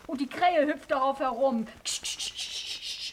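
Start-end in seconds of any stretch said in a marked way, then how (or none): 1.8-3.1 unvoiced soft shooing ksch sounds; shooing hiss. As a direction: shoo the crow away under your breath. Crow shooed off the glasses case.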